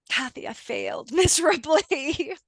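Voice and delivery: quoting him with a laugh breaking through; chuckling through a client's words; laughing while retelling. Retold or imitated. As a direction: laugh mid-quote at his failure. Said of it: "I'm not laughing at him".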